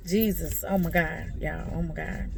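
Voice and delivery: real tears barely voiced; breaking down, calling on God; overcome with grief. Real, not acted. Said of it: "I had to let it out".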